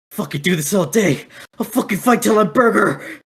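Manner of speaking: high, loud brash thug; gruff thug voice. Acted. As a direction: bark it like a hothead brawler. GM voicing brawler NPC.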